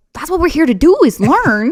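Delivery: high, loud, exuberant protest; high-pitched, over-the-top enthusiasm; eager young learner. Retold or imitated. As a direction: gush with a child's zeal for learning. Acting her eager childhood self.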